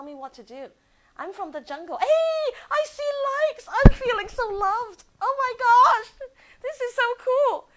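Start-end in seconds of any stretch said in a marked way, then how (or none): 2.0-7.7 much higher and louder, slowed with delight; real giddy joy at the likes; thrilled squeal. Real, not acted. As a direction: gush over the first likes. Sees first likes appear.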